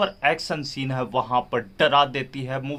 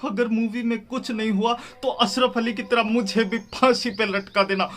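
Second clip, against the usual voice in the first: mock solemn oath; 6 semitones higher than usual. Mock oath, offering to be hanged.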